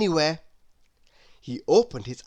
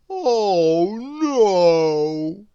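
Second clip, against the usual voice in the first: groan; 3 semitones higher, 7 dB louder than usual. A narrator's reading. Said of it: "groaned Mister Lazy".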